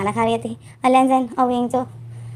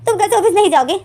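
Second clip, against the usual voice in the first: mock surprise; 8 semitones higher than usual. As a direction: feign shock, pitch jumping up. Mock shock at brother's announcement.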